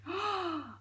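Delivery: sharp acted gasp; shocked gasp. Elephant hearing about crunching bones, shocked gasp. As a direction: gasp in horror. Elephant hears the horrible plan.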